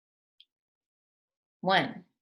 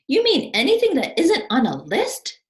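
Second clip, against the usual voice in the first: higher, louder questioning voice; Wallace puzzled; 8 semitones higher, 9 dB louder than usual. Acted. Wallace asking Albert, higher and doubtful. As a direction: ask it in anxious disbelief.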